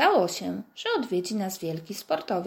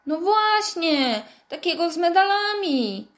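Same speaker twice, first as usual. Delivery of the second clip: with resentment; 9 semitones higher, 6 dB louder than usual. Acted. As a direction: whine it out, feeling cheated. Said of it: "zawołał z pretensją Wojtek".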